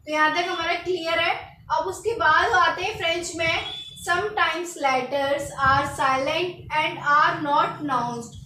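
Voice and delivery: normal talking voice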